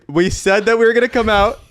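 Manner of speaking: ordinary talk, nothing marked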